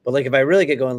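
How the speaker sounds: ordinary delivery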